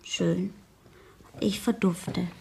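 quiet, whispered, sulking; sulky whisper as she is sent out of the room. Acted. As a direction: mutter it as you give in. Gigi sulks off to her room.

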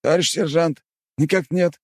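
high, frightened bleating voice; scared bleat. Acted. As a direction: bleat it out, caught red-handed. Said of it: "испуганно проблеял усатый".

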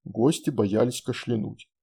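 ordinary delivery, nothing marked

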